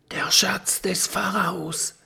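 high, awed stage whisper; hushed, breath-filled discovery. A narrator's reading. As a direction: whisper it in wonder. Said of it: "flüstert Kokosnuss leise".

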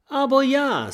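bright high answer. A narrator's reading. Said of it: "sagt Kokosnuss".

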